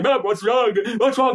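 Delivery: put-on high, squealing worried child; hammed-up panic, voice way up; mock distress. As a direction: fret loudly in a squeaky voice. Acting Robin rushing to sick mum.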